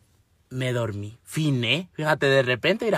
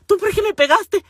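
high-pitched mock protest; indignant complaint; 13 semitones higher, 4 dB louder than usual. [name] confronting the sister who slapped her, high-pitched protest. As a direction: whine it way up high as an accusation.

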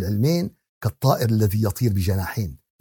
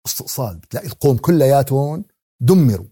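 normal talking voice

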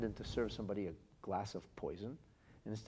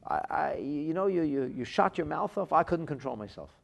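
loud, higher, defensive blurting; exasperated excuse; 5 semitones higher, 6 dB louder than usual. Retold or imitated. Man caught losing his temper, loud defensive excuse. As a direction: act out a hot-headed man excusing himself. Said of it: "And the person later says".

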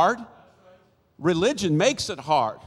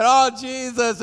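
higher, slow mock wail; high, drawn-out retold plea; retold crying; 7 semitones higher, 4 dB louder than usual. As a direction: imitate an upset mother crying out. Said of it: "and she's crying".

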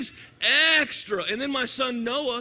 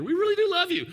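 laughing reassurance; 9 semitones higher, 4 dB louder, faster than usual. Real, not acted. Joke about crackheads in audience.

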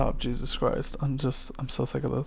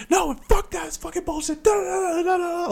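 very high reenacted rant; angry, near tears. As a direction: squeal out a retold furious protest, trailing into filler syllables. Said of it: "so angry, I'm almost in fucking tears".

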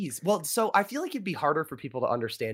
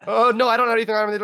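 mock fast auctioneer denial; 9 semitones higher, 8 dB louder, faster than usual. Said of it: "They'd be like".

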